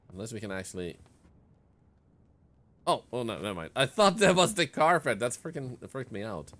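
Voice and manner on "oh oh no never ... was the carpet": sudden loud startled outburst. Real, not acted. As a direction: jump scare reaction. Startled by something in game.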